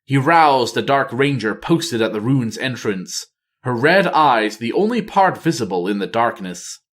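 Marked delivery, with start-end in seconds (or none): none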